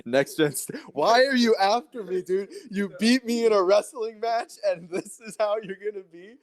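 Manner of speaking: high-pitched mock whine, hurt and pleading; mock whining. Whining at the friend who beat him wrestling, high and pleading. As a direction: play the wounded buddy, whining high.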